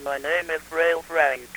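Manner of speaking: synthetic voice of a frail man; Frail Frank. Shown as a demonstration. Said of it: "many different voices to choose from".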